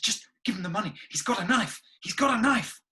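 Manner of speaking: put-on panicked girlfriend.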